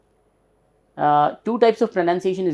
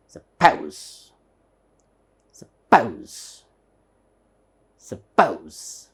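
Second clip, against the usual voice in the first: SUPPOSE as a faint "suh", then falling POUZ; barely touch "suh", land on "pose" in a low falling tone; "suppose" with feeble first syllable, stressed second; 8 semitones lower, slower than usual. Shown as a demonstration. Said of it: "there is an upper stress here".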